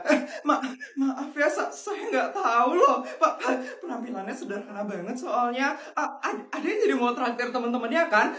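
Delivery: nervous saleswoman stammering and giggling; flustered shop clerk. Acted. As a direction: stammer and giggle, desperate to sell. Clerk fawns over rich kid.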